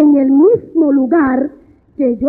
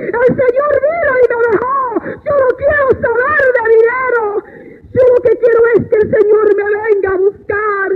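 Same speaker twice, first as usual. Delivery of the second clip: retold desperate screaming; 8 semitones higher than usual. Woman refusing the money cries for the Lord, anguished high wail. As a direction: cry it out in anguish, reliving it. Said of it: "yo comencé a gritar".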